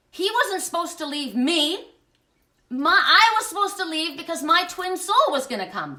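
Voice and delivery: high, loud retold protest; worked-up, aggrieved retelling; indignant outburst. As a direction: protest the unfairness with emphatic force. Indignant retelling of being left.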